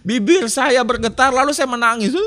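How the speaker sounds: laughing through the words, high and loud. Real, not acted. Preacher laughing at his teenage self, laughing. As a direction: chuckle while telling of trembling lips. Laughing while telling crying story.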